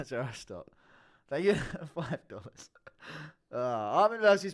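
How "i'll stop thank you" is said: normal talking voice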